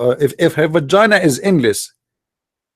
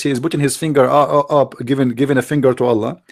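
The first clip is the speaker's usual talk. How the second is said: ordinary talk, nothing marked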